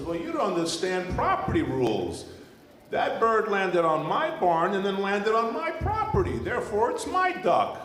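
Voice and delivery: high, insistent farmer voice; burly farmer. Acted. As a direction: lay down the law like a farmer. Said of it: "big, burly farmer".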